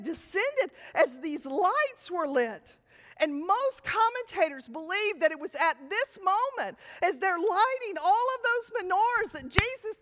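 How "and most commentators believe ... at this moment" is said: normal talking voice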